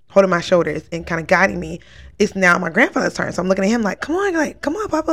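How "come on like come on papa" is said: pleading call. Retold or imitated. Urging her dying grandfather.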